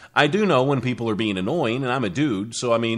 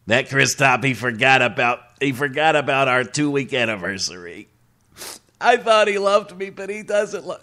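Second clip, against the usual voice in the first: creepy guy voice. Retold or imitated. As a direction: voice the girlfriend in a creepy man's voice. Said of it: "the creepy guy voice for the girl".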